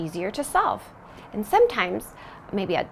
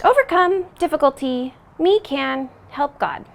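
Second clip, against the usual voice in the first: words in reverse order, loud and puzzled; backwards speech; 4 semitones higher, 6 dB louder than usual. Pretend. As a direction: try the backwards phrase again, confused. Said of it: "I'm saying it backwards".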